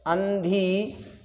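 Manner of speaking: अंधीकृत drawn out very slowly; अंधीकृत slowly, each syllable held; slow sounding-out. Shown as a demonstration. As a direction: stretch अंधीकृत into an... dhee..., pausing. Teacher sounds the word out slowly.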